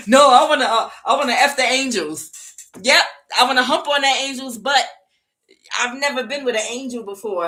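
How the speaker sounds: loud, high leering mob voice; leering shout with a laugh; lustful mob voice. Acted. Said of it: "the reprobate mind was like".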